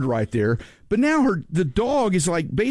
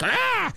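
high squawk imitating a startled bird; mock bird squawk. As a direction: squawk like a bird hit by the hose. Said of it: "kinda is like".

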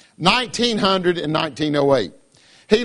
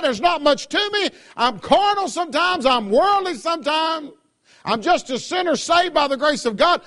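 higher, earnest plea to the Lord; imagined deathbed prayer; 7 semitones higher than usual. Pretend. A believer at life's end tells the Lord, higher earnest plea. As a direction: pray it humbly, voice lifted up. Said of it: "I want to be able to die".

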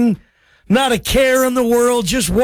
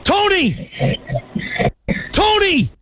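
calling out; 4 semitones lower than usual. Real, not acted. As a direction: yell his name to wake him up. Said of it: "He's snoring".

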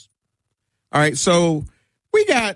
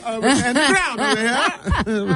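very high hammed-up Jamaican accent; laughing through a mock Jamaican accent; 14 semitones higher than usual. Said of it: "when I try to do Jamaican accents".

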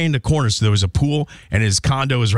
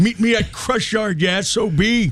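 put-on high cranky old man challenge; an old man picking a fight; 13 semitones higher than usual. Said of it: "your old man voice again".

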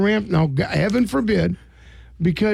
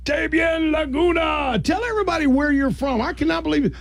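hyped-up announcement; 7 semitones higher than usual. Pretend. Radio host introducing special guest to listeners, hyped up. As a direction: whoop up the guest's big entrance.

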